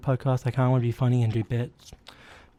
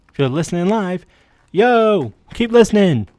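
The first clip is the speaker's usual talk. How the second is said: higher and louder, hyped up; radio hype; 7 semitones higher, 6 dB louder than usual. Real, not acted. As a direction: pump up the live listeners. Hyping live radio listeners.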